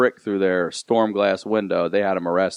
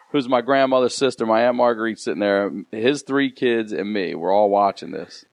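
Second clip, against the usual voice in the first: ordinary delivery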